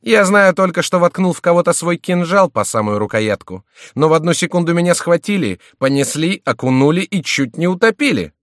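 high, loud, agitated account; worked-up, aggrieved narration of an ordeal; heated retelling. A narrator's reading. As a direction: pour it out indignantly, pitched high. Victim heatedly retells his ordeal.

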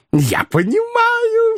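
very high, drawn-out squeal of agreement; exclaiming cry. A narrator's reading. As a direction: cry out high and slowly stretched. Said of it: "вскричал Коровьев". (восторженный визгливый вскрик)

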